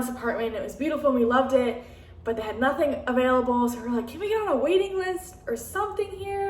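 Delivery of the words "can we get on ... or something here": high, eager asking voice; hopeful plea. Retold or imitated. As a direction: lift the pitch way up, hopeful. Asking apartment office, retold.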